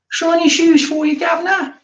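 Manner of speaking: very high, squeaky hammed-up Cockney; Cockney orphan. Dickensian orphan touting shoe shines, very high mock Cockney. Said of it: "like an orphan from a Dickensian novel".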